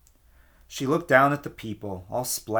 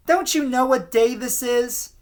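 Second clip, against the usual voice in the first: high, louder, annoyed housewife voice; annoyed woman; 11 semitones higher, 4 dB louder than usual. A narrator's reading. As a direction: snap at the stranger, high and irritated. Missus McLean annoyed.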